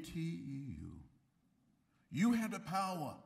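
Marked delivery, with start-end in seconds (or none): 2.1-3.2 rousing call. Real, not acted. Rallying the audience.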